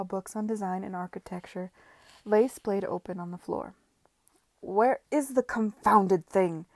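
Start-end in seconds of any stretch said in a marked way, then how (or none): 4.6-6.7 louder, slow frustrated shout. Acted. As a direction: bellow at the lost paper. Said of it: "he shouted".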